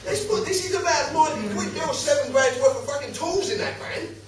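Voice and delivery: high-pitched, exasperated retold rant; indignant rant. Householder rows with the copper over stolen tools, exasperated. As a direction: pitch it high and argue the injustice.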